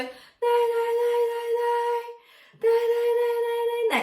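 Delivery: high, breathy nay exercise; airy falsetto nays. Shown as a demonstration. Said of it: "Try it really breathy first".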